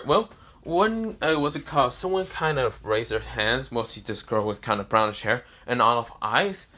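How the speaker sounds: usual speaking voice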